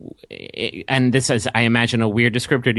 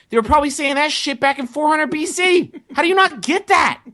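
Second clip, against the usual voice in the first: high-pitched incredulous outburst; exasperated rant. Real, not acted. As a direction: climb into exasperated disbelief, much higher. Ranting at clueless chanting fans.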